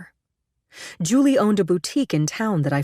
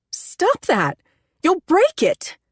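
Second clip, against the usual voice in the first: high, loud, alarmed yell; frightened shout; 13 semitones higher, 3 dB louder, much slower than usual. A narrator's reading. Woman yelling in fear as her lover hits the window, terrified. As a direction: shout at him to stop, scared.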